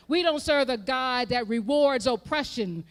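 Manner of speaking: loud declaration; thundering sermon line; forceful preaching. Acted. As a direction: drive it home hard. Preaching emphasis.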